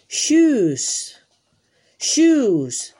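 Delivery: shoes said high and loud with a long sh; emphatic model word. Shown as a demonstration. Teacher models shoes for children, loud and high. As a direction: call out shoes for the kids to copy. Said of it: "A ver, repite".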